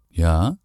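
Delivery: Ja with a rising question tune. A narrator's reading. Answering the phone, Ja? as a question. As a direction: let "Ja?" rise like a question. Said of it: "lasse das Ja als Frage klingen".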